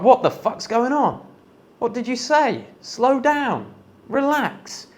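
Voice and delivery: high-pitched mock startled protest; alarmed, bewildered reaction. Acted startled reaction to being shouted at.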